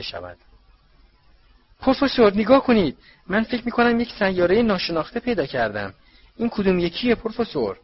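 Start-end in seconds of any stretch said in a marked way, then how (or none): 1.8-7.8 put-on high, loud girlish squeals of excitement; excited squeal. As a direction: shriek it out, thrilled by her discovery.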